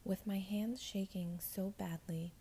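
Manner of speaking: ordinary delivery, nothing marked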